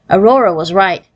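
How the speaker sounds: ordinary delivery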